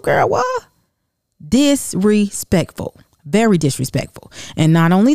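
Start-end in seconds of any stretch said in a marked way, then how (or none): 0.3-0.7 sudden high squeak of disbelief; a disbelieving yelp, up high; shocked what. Real, not acted. Outraged at curse words in group. 1.5-3.0 slow, stretched disrespectful; drawn-out judgment. Real, not acted. Judging the cousin's curse words.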